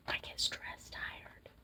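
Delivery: barely audible fading whisper; faint whisper. Real, not acted. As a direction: let it fade to almost nothing. Repeats tired phrase under breath.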